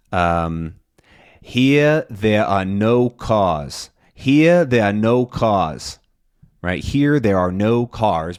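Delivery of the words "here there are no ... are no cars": slow, R-less New York demonstration; New York accent. Shown as a demonstration. Teacher demonstrating a practice phrase, slow New York accent. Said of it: "the phrase that I like to use".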